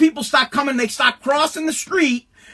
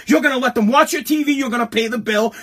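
ordinary delivery

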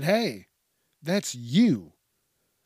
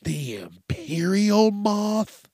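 ordinary talk, nothing marked

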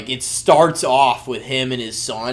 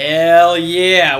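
excited cheer; 4 semitones higher, 9 dB louder than usual. Real, not acted. Cheering the movie review.

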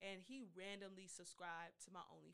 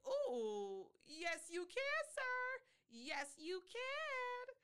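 excited squeal; 8 dB louder, much slower than usual. Retold or imitated. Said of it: "And I was like".

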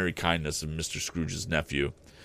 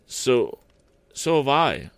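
put-on boy's voice, higher and eager; cried out; 7 semitones higher, 6 dB louder, slower than usual. Peter agreeing with his mother, eager cry. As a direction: jump in eagerly, a little louder. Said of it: "cried Peter".

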